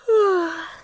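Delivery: loud, heavy sigh. Acted. Mistress sent off to embroidery class, heavy sigh. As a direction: let out a big sigh.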